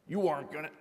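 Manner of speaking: put-on a brash American accent urging violence; American dark passenger. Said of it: "I don't know why he's American".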